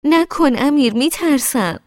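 high, loud frightened protest; scared and angry. Acted. Girl pushed toward the cliff edge by Amir, frightened angry protest. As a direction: cry out scared and cross at him. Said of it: "وحشت زده و عصبانی گفتم".

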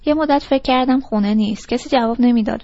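usual speaking voice

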